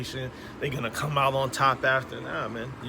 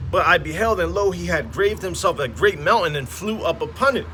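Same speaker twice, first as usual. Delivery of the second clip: louder, solemn King James reading; scripture reading; 3 semitones higher, 6 dB louder than usual. Acted. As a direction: proclaim the verses like a preacher. Reads scripture aloud.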